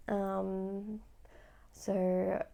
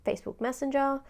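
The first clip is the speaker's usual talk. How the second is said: ordinary talk, nothing marked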